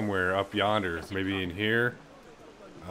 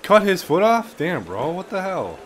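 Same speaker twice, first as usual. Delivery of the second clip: shocked; 10 semitones higher, 8 dB louder, slower than usual. Real, not acted. Gamer reacts to brutal scene.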